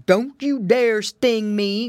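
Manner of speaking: loud, slow growl of warning; growling wolf. A narrator's reading. Wolf warns off the bees, growling. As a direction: growl a drawn-out threat. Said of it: "growled the wolf".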